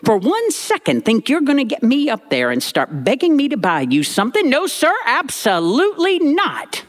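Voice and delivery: high-pitched, sharp grandmother warning; Granny warning. Retold or imitated. Granny warning her grandson not to beg, high and bossy. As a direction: wag a finger at him, high and sharp. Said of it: "she turned to me and said".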